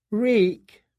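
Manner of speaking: high, cowering whimper; broken prisoner. A narrator's reading. Prisoner answering the boys in tears, high and cowed. Prisoner repeating his name.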